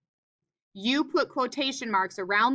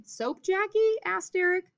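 "Soap Jackie?" pitched high as a question; teacher voicing Eric's question, pitched high; high questioning read; 8 semitones higher than usual. Shown as a demonstration. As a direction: ask it up high then tag "asked Eric".

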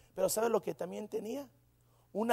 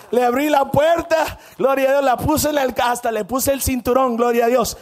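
higher, very loud triumphant delivery; jubilant preaching shout; 5 semitones higher, 13 dB louder than usual. Real, not acted. Preacher tells church how the gas money came, jubilant shout. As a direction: belt it out like a victory report.

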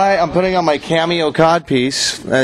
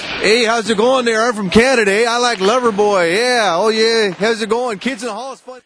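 higher-pitched Canadian hockey bro; 6 semitones higher than usual. Pretend. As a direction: play a goofy Canadian hockey player, eh. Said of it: "I'm gonna do my impression of a hockey player".

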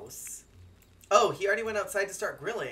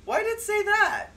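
baffled; 8 dB louder than usual. Real, not acted. Confused by game text.

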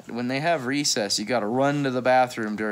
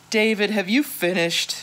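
teacher asking; 8 semitones higher, 5 dB louder than usual. Acted. Teacher checking on David.

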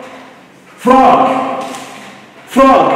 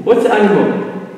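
ordinary talk, nothing marked

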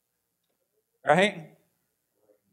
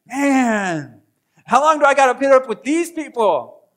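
loud, high exasperated outburst; fed up; 7 semitones higher, 6 dB louder than usual. Acted. Preacher voicing someone fed up with people, exasperated. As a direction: vent at the end of your patience. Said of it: "Anyone ever feel like".

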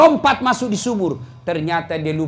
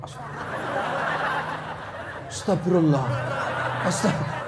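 mock pious murmur; 5 dB quieter, slower than usual. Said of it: "baru kau melotot".

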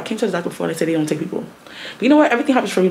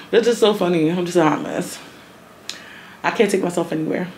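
normal talking voice

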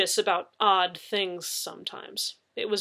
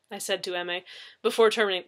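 ordinary delivery

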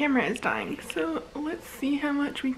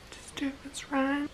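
soft whispered lyric; 3 semitones higher, 9 dB quieter than usual. Real, not acted. Fan whispering the lyric along, quiet. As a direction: mouth it under your breath.

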